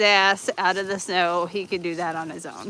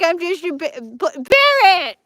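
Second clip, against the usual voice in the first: high, stuttering mock dog voice; stammer, then burst out loud and high; playful dog voice building to a shout; voicing the dog; 9 semitones higher, 8 dB louder than usual. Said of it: "He's like".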